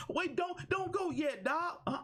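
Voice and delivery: very high-pitched mock plea; mock pleading. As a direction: squeal it like a fan begging him to stay. Voices viewers begging for more.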